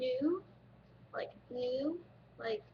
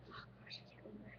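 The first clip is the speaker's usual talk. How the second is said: unvoiced whispered aside, much softer; 3 semitones lower, 15 dB quieter, faster than usual. Real, not acted. Girl unsure of the day, whispering to herself. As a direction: breathe it to yourself, forgetting.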